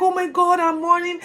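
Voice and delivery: high, loud lament; mourning king. Acted. Josiah tearing his clothes, loud and high. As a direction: cry out in grief while tearing his robe. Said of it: "and he tears his outfit".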